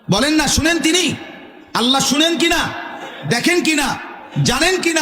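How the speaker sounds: high shouted questions to the crowd; fiery sermon call, pitched high and shouted; preacher's shout. Acted. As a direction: hammer each question out high. Preaching at full force.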